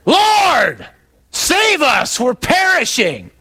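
high, drawn-out shout for help; panicked yell. Acted. Disciple yelling to the back of the boat in a storm, panicked shout. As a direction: yell it desperately over a roaring storm. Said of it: "one of us yells to the back of the boat".

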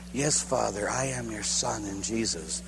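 low, hushed reply to the Father; humble answer. Retold or imitated. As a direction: submit softly, almost under the voice. Answering God in the dream.

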